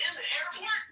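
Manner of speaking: high, incredulous question; shocked disbelief. Retold or imitated. Luggage held hostage at the airport, high shocked question. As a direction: shoot back in disbelief.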